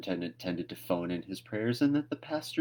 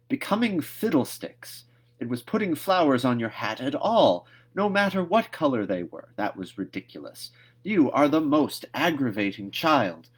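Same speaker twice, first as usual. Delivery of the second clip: higher, louder cross outburst from a stern guardian; exasperated scolding; 5 semitones higher, 4 dB louder than usual. Acted. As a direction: snap at the child in exasperation. Marilla scolding Anne crossly.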